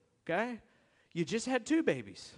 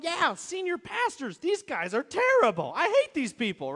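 louder, higher mock complaint; irritated griping; 7 semitones higher, 6 dB louder than usual. Pastor back from lunch griping about bosses, loud. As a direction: vent about the bosses, half-hamming it. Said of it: "I would leave angry and irritated and going".

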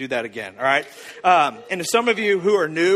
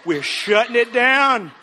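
higher, louder, drawn-out decree; emphatic declaration; 5 semitones higher, 5 dB louder, slower than usual. Acted. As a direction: slam the door on it, slowly. Bad-advice character declares affection shut off.

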